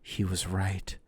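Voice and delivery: mock-fearful, feeble murmur; faint. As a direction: let it slip out faintly. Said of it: "A faint".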